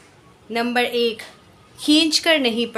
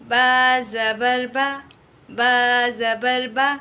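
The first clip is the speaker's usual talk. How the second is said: slow, soft बा ज़बर बा without stretching or jerking; soft gentle reading; much slower than usual. Shown as a demonstration. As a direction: read बा ज़बर बा gently and slowly. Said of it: "बहुत ही आसानी से".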